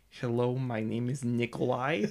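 slow Russian accent; exaggerated, unhurried Russian accent. Shown as a demonstration. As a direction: introduce yourself in a drawn-out Russian accent. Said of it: "when it comes to my Russian accent".

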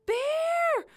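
very high, loud alarm cry; terrified yell. Acted. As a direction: yell a warning at the top of your voice. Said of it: "she yelled".

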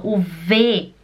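usual speaking voice

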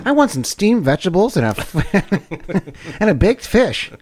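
high, slow put-on voice; slow, high, hammed-up health nut; prissy mock voice. Mocking a healthy eater.

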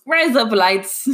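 slow, drawn-out Australian accent; exaggerated Aussie attempt. Shown as a demonstration. Trying the trick phrase, stretched Australian accent. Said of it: "In an Australian accent".

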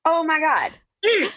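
high, excited victory cry; triumphant. Real, not acted. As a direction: crow about being right. Triumphant after Googling.

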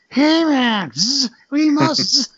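high, loud voice broken up by buzzing. Retold or imitated. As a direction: imitate a cartoon bee-man, shrieking up high with drawn-out buzzes. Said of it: "he went for, like, a high pitch".

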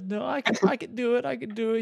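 high-pitched eager protest; mock pleading. Retelling own plea to teacher.

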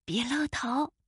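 very soft, furtive warning; hushed. A narrator's reading. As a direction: keep it right down, as if hiding. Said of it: "欢仔小声说".

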